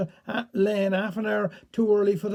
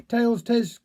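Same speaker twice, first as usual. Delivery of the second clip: ordinary delivery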